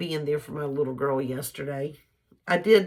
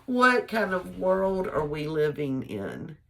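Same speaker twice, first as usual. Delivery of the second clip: P=A; slow disbelief; slower than usual. Retold or imitated. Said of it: "it just makes me go".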